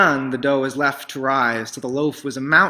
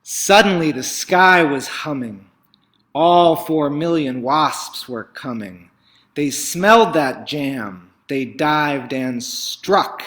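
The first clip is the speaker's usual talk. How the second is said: louder and slower, rising tension; dramatic build; 3 semitones higher, 7 dB louder than usual. A narrator's reading. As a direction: make the swarm arrive. Wasps arriving, climax.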